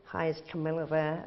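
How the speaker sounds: lightly put-on American accent, asking politely; a hint of an American caller on the phone. Caller phoning her asking for Camilla in a light American accent. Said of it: "My American accent isn't great".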